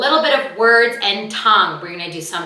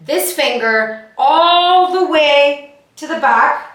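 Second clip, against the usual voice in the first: loud drawn out; 5 semitones higher, 5 dB louder, much slower than usual. Shown as a demonstration. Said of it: "a nice loud, loud sound".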